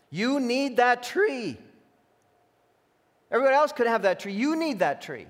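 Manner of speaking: a lightly voiced serpent, growing insistent; pushy tempter. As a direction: press the craving on him hard. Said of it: "Trying to put in their minds unmet needs".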